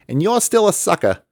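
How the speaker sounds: high, loud teasing call; loud mocking call. A narrator's reading. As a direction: call it down from the air. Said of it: "he called down".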